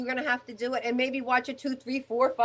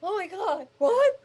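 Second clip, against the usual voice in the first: shocked reaction; 7 semitones higher than usual. Retold or imitated. Retelling their reaction to the DVD.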